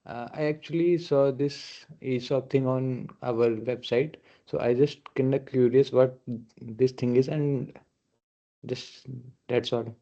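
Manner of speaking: faint, distant call audio; speaking from far off through a webcam mic; on a video call. Real, not acted. Second remote participant over call.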